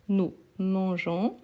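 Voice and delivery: slow "nous mangeons" with a soft zh; soft J sound. Shown as a demonstration. Teacher modelling the correct form, slow "mangeons" with soft J. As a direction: draw out "mangeons", soft zh before the nasal ending. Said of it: "avec le son de jon".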